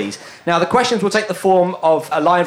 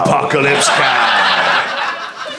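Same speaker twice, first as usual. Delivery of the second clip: slow, low trailer shout; movie trailer voice; 6 semitones lower, much slower than usual. Pretend. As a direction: boom it like a trailer title. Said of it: "use the movie trailer voice".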